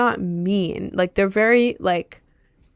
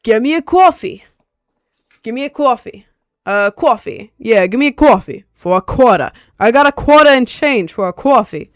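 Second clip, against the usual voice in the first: brash, louder New York customer impression; New York accent; 4 semitones higher, 8 dB louder than usual. Mimicking customers at the Starbucks register, heavy New York accent. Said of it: "People would walk in and be like".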